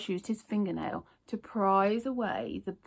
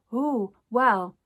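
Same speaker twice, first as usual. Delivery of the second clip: sweet smile; 6 semitones higher, 4 dB louder than usual. A narrator's reading. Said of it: "with a sweet smile".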